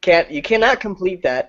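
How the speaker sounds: ordinary delivery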